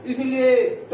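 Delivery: ordinary talk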